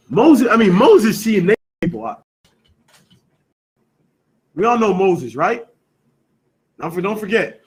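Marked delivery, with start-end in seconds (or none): 0.1-2.2 loud, high-pitched incredulous outburst; mock astonishment. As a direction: play up the shock for the listeners. Repeats the shocked question to listeners.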